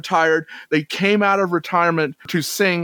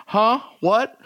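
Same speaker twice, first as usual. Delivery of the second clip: lightly voiced baffled kid, higher pitch; puzzled kid; 5 semitones higher than usual. Imagined kids hearing about an old sitcom, mock puzzlement. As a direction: play a confused young listener, questioning. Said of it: "Any kids that may be listening saying".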